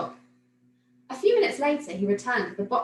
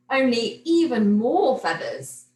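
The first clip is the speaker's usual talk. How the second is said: dreamy wish; 6 dB louder, slower than usual. A narrator's reading. Millie imagining her hat.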